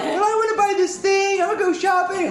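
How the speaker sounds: very high, mocking whiny voice; hammed-up high-pitched complaint; whining players. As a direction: mimic petulant crewmates who want to shop.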